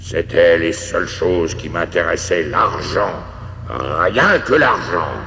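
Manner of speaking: loud, slow, drawn-out spectral warning; haunt him with heavy, booming regret; grim ghost. Acted. Ghost warns Paul of the chains awaiting him, grim and loud. Ghost figure speaking in story.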